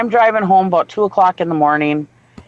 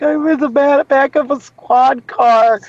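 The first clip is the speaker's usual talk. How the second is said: retold bawling; 8 semitones higher, slower than usual. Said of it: "I'm just bawling my eyes out".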